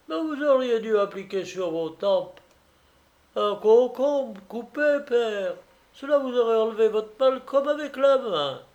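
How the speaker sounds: put-on a fussing girl asking after him; fussing young woman. Praskovia greets the suitor on the doorstep, fussing.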